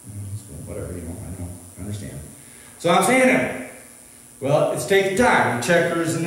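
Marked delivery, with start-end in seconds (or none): 0.1-2.2 low, hushed acted reply; quiet resignation. Shopper privately putting up with a long checkout wait, quiet resignation. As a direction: murmur a patient brush-off, well under your usual voice.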